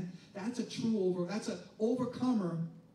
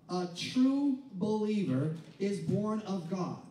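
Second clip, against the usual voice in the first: normal talking voice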